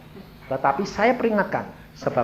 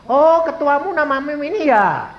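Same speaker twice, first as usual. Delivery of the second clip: loud, high "oh" of recognition; acted realisation; 11 semitones higher, 8 dB louder than usual. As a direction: pounce on it as you realise. Retold sudden realisation to the intruder. (seolah baru tersadar, lantang)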